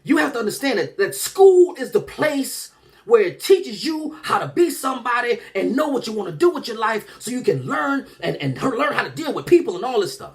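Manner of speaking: put-on high, earnest mother imploring her child; begging and pleading. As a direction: plead as a mom desperate to be understood. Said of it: "begging and pleading and just trying".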